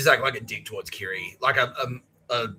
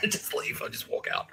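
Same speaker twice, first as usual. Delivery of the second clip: ordinary talk, nothing marked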